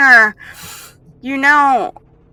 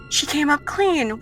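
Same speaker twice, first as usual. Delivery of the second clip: high-pitched, falling "She came up clean"; suspicious musing; 7 semitones higher than usual. Acted. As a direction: repeat "She came up clean" higher, doubting it. Villain repeats result with suspicion.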